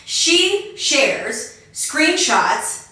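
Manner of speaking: each word drawn out slowly; slow, careful enunciation. Shown as a demonstration. Host retrying the tripped-over line, slow careful enunciation. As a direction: pick through the tongue twister word by word. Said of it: "say that five times fast".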